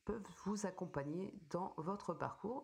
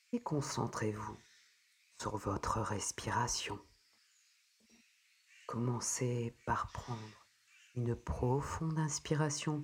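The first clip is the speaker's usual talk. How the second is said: soothing spa whisper; 3 semitones lower, 8 dB louder than usual. Acted. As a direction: ease the listener gently into calm. Guides a breathing meditation.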